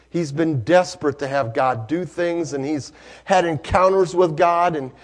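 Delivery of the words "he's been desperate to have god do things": ordinary delivery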